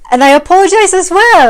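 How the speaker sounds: real emotion cracks the voice upward; voice breaking with emotion. Real, not acted. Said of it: "it makes me emotional even talking about it".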